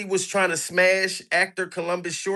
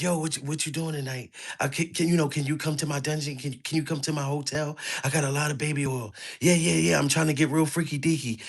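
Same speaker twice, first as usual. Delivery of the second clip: hushed, lower-pitched mock phone voice; talking real low; 6 semitones lower, 7 dB quieter, faster than usual. Mimicking a caller secretly inviting a man to his hotel, talking real low. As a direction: imitate a furtive late-night caller, soft, low and quick. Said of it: "He was talking real low".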